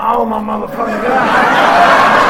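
slow, loud drawn-out shock; shocked dad reacting; stunned disbelief. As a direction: let it land slowly. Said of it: "I'm like".

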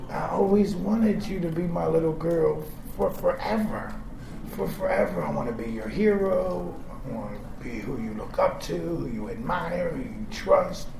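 low, soft heartfelt promise; soft loving father; tender. Retold or imitated. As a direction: speak it gently like to a child. Tender dad monologue to daughter.

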